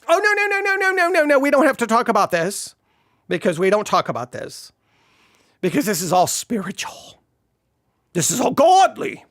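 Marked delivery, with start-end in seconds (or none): 0.1-2.7 high, rapid panicked no's; flustered refusal, squeaking no no no; mock panicked church. Church panicking at talk of bodies, high flustered protest. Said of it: "So we're like". 8.1-9.2 higher, put-on holy tone; sanctimonious mock reassurance; mock pious voice. Church insisting it is all spiritual, mock pious.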